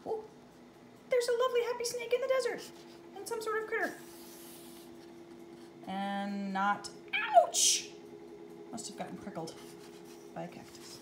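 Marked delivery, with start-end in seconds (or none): 1.1-3.9 excited. Real, not acted. Delighted describing picture. 8.7-11.0 hushed, almost whispered aside; soft aside. Real, not acted. Sympathetic aside.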